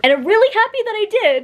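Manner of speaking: high, gushing delight; thrilled high-pitched book fan; excited gush. Real, not acted. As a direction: squeal out your happiness. Gushing over new book set.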